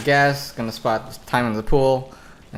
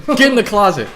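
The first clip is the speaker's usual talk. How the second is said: mock yell; 10 semitones higher, 6 dB louder, faster than usual. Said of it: "heard me, like, yell at you".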